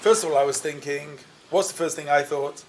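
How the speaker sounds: usual speaking voice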